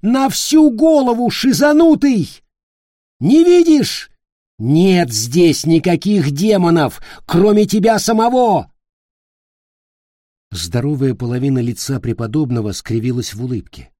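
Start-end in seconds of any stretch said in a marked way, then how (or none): 0.0-8.7 high furious yelling. A narrator's reading. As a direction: keep screaming, words hurled out. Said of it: "заорал в ответ Ник". (яростный крик)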